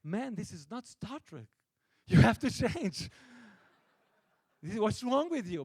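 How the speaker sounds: mock-scolding the actor, building to a shout; retold exasperated yell at the star; exasperated outburst. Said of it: "And I was like".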